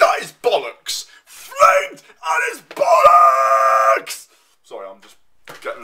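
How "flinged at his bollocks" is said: screamed far higher and louder, stretched slowly; overreacting scream. Real, not acted. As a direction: let it burst out in a shrieking yell. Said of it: "getting overreacting there".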